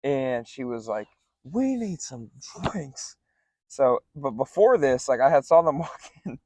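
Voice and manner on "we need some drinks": put-on slow, slurred drunk demand; mock drunk customer, higher and slow; drunk woman. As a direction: drag the words out like she's hammered.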